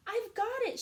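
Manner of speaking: the idea strikes like lightning; thrilled snail crying out; excited cry. Acted. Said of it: "she cried".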